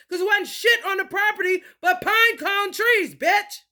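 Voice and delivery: high, loud, indignant tirade; heated rant. Real, not acted. Schooling a doubter about pine cones, heated rant. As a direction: rant at them, pitch pushed up high.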